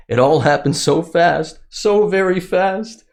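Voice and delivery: rising high, slow and drawn out; mock-victim voice. Reading scared former soldier's account, mockingly melodramatic. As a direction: build into an overdone, pitiful storyteller.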